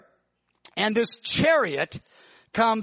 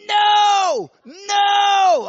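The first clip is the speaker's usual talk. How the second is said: high, loud shouted no no; cry out; 13 semitones higher, 8 dB louder than usual. Retold or imitated. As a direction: scream it in desperate refusal. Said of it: "cry out".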